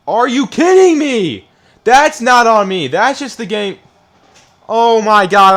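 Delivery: loud, high-pitched furious rant at the game; raging yell. Real, not acted. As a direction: blow up at the game, shouting. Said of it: "all the yelling and screaming and the raging".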